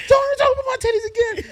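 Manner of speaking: high, loud, fast mock scream; imitating furious friend. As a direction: yell the warning in her outraged voice. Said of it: "she got so mad".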